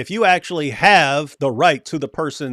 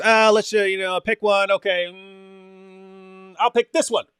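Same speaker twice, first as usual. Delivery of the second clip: airy, higher mock voice picking a number at random; a hammed-up idle chooser, pitched up; mock careless picker; 4 semitones higher than usual. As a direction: act like someone stabbing a finger at a list. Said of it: "That's not just".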